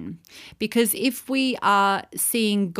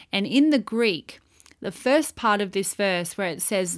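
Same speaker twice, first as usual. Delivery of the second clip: normal talking voice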